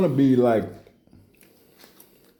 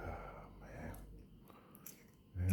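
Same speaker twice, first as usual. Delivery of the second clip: breathy whispered sigh of boredom; whispered groan; 3 semitones lower, 23 dB quieter than usual. Pretend. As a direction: let it out under your breath. Bored muttering aside.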